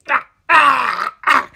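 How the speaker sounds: strained high choking cries; dying gasps. Acted. Narrator choking out cries while dying. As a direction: gasp out as if passing out.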